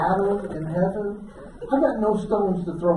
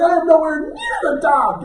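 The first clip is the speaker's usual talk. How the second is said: high-pitched exasperated cry; frantic protest; 7 semitones higher than usual. Retold or imitated. Paddler calling up to the ship's bridge, frantic high protest. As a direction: call up in strained protest.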